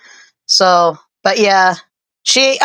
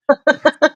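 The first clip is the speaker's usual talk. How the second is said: laughing; 8 semitones higher than usual. Real, not acted. Laughs after saying whatever.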